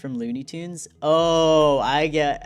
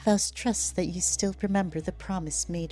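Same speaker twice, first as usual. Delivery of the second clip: higher, softer, measured voice; soft-spoken, higher-pitched character voice; composed game character; 4 semitones higher, 4 dB quieter than usual. Acted. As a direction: speak high and quietly, in character. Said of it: "In a quiet but coldly stern voice".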